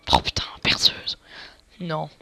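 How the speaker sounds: whispering. Real, not acted. Drill breaks again.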